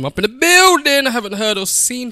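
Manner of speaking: high, loud hype announcement; hype intro. Real, not acted. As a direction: announce yourself to the crowd.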